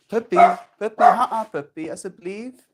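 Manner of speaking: puppy talk. Real, not acted. Talking to the dog.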